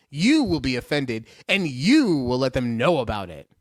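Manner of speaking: "you" leaps up in pitch both times, the rest drops away; stress on YOU. Real, not acted. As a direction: hit each "you" hard, not "will". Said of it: "put the emphasis on you".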